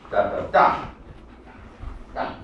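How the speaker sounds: "Da, da, da" tapped out as three even beats; beat syllables. Shown as a demonstration. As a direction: sound the rhythm on "da". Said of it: "The rhythm is three".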